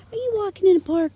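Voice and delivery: quick high coo at the pup; talking to a dog. Real, not acted. Repeats faster to the dog.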